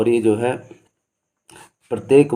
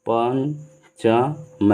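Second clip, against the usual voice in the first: very slow example word; much slower than usual. Shown as a demonstration. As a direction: stretch पंचम so the nasal is heard. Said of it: "जैसे". (पंचम शब्द को बहुत धीरे खींचकर बोलिए)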